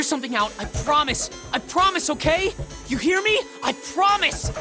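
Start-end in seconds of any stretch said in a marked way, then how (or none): 1.5-4.5 high, urgent yelling; desperate promising shout. Acted. Brother to sobbing trapped sister, desperate shout. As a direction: shout the promise so it gets through.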